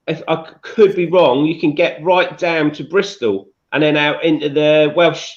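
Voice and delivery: ordinary delivery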